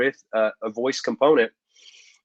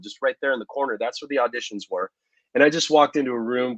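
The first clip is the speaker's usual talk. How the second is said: ordinary talk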